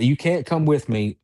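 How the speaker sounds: emphatic warding-off, retold; retold firm order. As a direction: tell the unseen thing to stay put.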